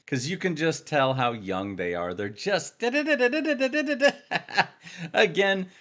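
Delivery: ordinary talk, nothing marked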